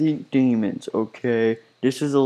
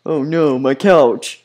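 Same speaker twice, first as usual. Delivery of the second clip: louder, hammed-up cry over the couch; mock dismay; 3 semitones higher, 8 dB louder, much slower than usual. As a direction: whine as the cartoon boss loses his couch. Joey Drew hit by couch.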